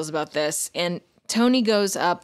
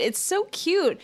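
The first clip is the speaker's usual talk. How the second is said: gushing; 10 semitones higher, slower than usual. Real, not acted. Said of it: "gushing again".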